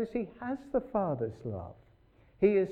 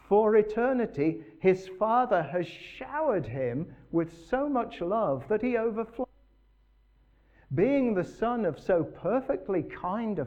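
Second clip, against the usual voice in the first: rising emphatic reading; 5 semitones higher, 4 dB louder than usual. Acted.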